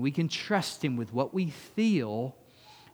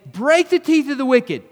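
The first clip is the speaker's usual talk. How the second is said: loud, higher raging cry; angry psalm; 12 semitones higher, 13 dB louder than usual. Retold or imitated. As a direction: rage at the wicked like David. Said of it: "He's angry and it ain't good".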